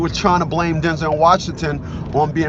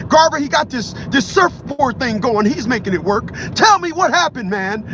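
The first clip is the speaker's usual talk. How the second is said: bully him with it, voice pitched way up; angry yelling; 9 semitones higher than usual. Retold or imitated. Terrorist threatening hostage, demanding answer.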